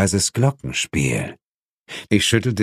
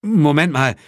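raised alarmed voice; 6 semitones higher, 3 dB louder than usual. Acted. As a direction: call out in sudden alarm. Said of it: "rief ich alarmiert".

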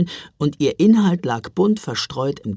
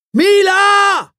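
a full, high, very loud yell; boy yells for his sister in the empty train; bellowing shout; 12 dB louder than usual. A narrator's reading. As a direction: shout it as loud as you can. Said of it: "brüllte Jonas so laut er konnte".

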